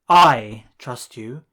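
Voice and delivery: I trust you, the I shouted out slowly; stress on I. Shown as a demonstration. Teacher contrasting emphatic I with the Klingon jech. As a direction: hit I hard and slow. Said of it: "you raise your voice to emphasize something".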